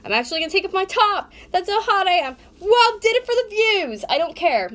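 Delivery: high, loud rant at the camera; exasperated outburst. Real, not acted. Sweating vlogger announcing her top comes off, high exasperated shouting. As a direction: burst out loudly, way up in pitch.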